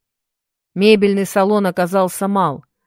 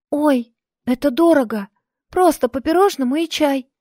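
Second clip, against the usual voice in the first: high squeak, much higher; squeaky; 8 semitones higher than usual. Acted. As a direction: squeak it out timidly. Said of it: "пискнула Мария".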